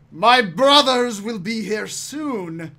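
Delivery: slow, loud, menacing giant; defiant giant threat. Acted. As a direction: threaten them with his last breath. DM voices the dying giant.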